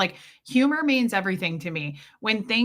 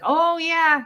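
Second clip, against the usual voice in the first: chipper mustache guy; 8 semitones higher, 5 dB louder than usual. Retold or imitated. Mustache guy replying to dad.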